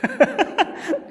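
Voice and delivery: high breathy laugh of relief; relieved laugh. Real, not acted. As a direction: laugh off the scare. Relief after finding child. (tawa lega bernada tinggi)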